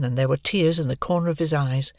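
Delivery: ordinary delivery, nothing marked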